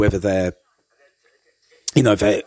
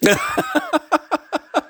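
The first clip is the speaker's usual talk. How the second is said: high laugh. Real, not acted. Laughs after the son-in-law lesson.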